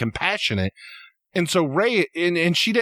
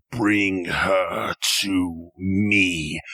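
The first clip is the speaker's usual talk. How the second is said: much lower, very slow cold command; angry Snoke; 7 semitones lower, much slower than usual. Retold or imitated. Snoke commanding Kylo, low and slow with cold anger. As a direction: drag out each word like a furious master. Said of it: "angry to me by the time he says".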